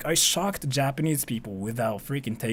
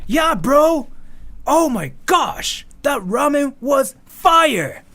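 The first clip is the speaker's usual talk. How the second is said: high, loud, slow bro hype; cartoon bro excitement, stretched out slowly; hyped bro voice; 11 semitones higher, 6 dB louder, much slower than usual. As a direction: gush like two bros over ramen. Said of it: "we were like".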